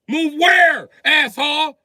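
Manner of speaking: high, loud snap back; indignant high shout; angry shout. Acted. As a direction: yell at him to back off. Yelling back at the boater.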